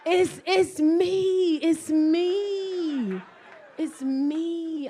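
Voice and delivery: high, loud drawn-out wail, easing off; wailed self-blame. Acted. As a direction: wail the admission, fading gradually. Drawn-out confession after outburst.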